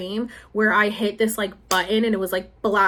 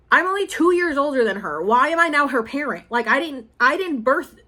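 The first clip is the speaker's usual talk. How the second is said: normal talking voice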